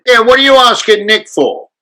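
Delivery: loud, high Cockney challenge; Bob Hoskins. Retold or imitated. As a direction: butt in loudly as an indignant Cockney. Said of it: "Bob Hoskins would happen to be".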